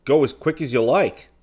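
high, loud, eager assent; urgent go-ahead. Acted. Seaton letting Dunark man the gun under attack, urgent go-ahead. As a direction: fire back permission with urgency. Urgent permission as battleships approach.

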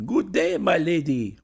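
high, loud sing-song greeting; cat's greeting. Acted. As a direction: chirp it like a cat's morning hello. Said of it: "purring, 'Good day, my lady'".

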